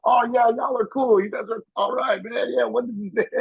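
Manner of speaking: high, won-over impression; sudden friendly mock voice. Star bought with pizza, high friendly mock voice. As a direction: flip to buddy-buddy, high and bright. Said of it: "He's like".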